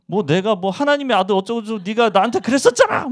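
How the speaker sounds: imagined Jesus grabbing Peter, accusing; angry confrontation. Pretend. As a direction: throw it in his face, grabbing his collar. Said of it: "막 이러면서 얘기하지 않았을까?".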